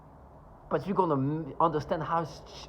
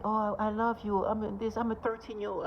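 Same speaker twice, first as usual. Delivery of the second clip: high, gushing mock teen voice, pitched up; mock lovestruck teen; 8 semitones higher than usual. To listeners, mimicking the kids who talk through the dogs, high mocking teen voice. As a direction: make fun of a lovestruck thirteen-year-old.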